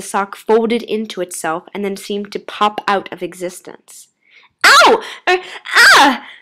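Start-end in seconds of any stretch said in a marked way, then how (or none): 4.6-6.3 high, loud yelps of pain; pained cries. A narrator's reading. Girl drawing her foot back, high pained cries. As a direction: series of startled, pained yelps. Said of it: "she called out".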